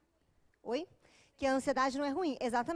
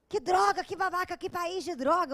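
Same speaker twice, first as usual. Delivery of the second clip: put-on boyfriend's high, loud road grumble; hammed-up outburst of irritation; mock angry cursing; 5 semitones higher, 5 dB louder than usual. Boyfriend cursing a driver who cuts in, mock angry.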